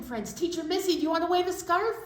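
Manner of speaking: ordinary delivery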